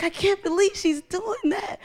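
high-pitched retold amazement; high, astonished mock cry; tearful disbelief. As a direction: imitate a woman overcome with disbelief. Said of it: "She's crying".

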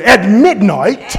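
slow drawn-out preaching cadence. Shown as a demonstration. Preacher shows how to preach the line, slow and grand. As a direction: stretch it out like a revival preacher. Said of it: "you gotta preach it real good".

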